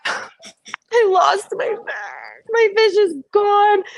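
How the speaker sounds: mock wail. Finding fish missing.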